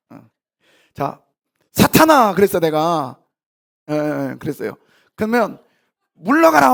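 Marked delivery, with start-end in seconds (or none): 1.7-2.3 loud, high call of the name; stern rebuke. Acted. Preacher re-enacts Jesus naming Satan, loud and high. 6.1-6.7 high, loud, ringing command; commanding rebuke. Acted. Preacher thunders Jesus' rebuke at the congregation, high and loud.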